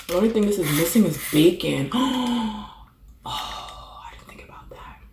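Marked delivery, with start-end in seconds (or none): 2.0-2.8 sharp gasp of realisation; startled gasp; sudden gasp. Real, not acted. Realises burger lacks bacon. 3.3-5.0 hushed whisper to herself; whispered regret. Real, not acted. Whispers realisation, then repeats loud.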